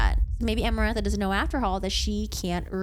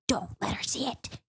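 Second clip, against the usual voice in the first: whispered inner voice; hushed, intense self-command; urgent whisper; 4 dB quieter than usual. Acted. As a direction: whisper it like a secret warning. Inner voice urging her not to cry.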